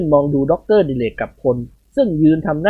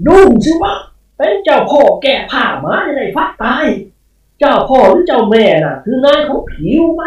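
high, loud, jolted spirit retort; startled spirit snapping; 9 semitones higher, 5 dB louder than usual. A narrator's reading. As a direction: bark back caught off guard. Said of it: "เจ้าพ่อทุ่งสะดุ้งโหยง". (เจ้าพ่อสะดุ้งแล้วตวาดกลับ)

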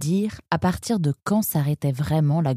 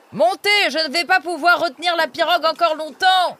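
boatman's shout; 12 semitones higher than usual. Acted. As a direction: shout at him to jump in now. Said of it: "lui cria le batelier".

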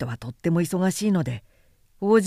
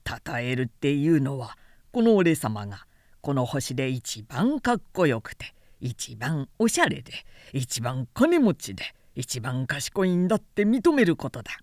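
vain man; 6 semitones lower than usual. A narrator's reading. Vain man demanding praise, lower pompous voice. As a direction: puff up like a self-important man.

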